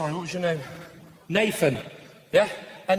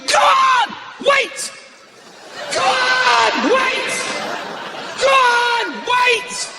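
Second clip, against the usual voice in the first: very high, loud yelps; abrupt high-pitched shouting; imitated shouted outbursts; 5 dB louder, much slower than usual. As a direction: mimic a man helplessly yelling commands. Imitating the man shouting at roadside.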